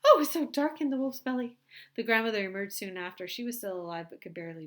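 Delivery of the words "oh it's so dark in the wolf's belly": put-on high, loud relieved frightened girl; high, loud shaken little girl voice; exclaiming girl. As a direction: burst out of the belly exclaiming.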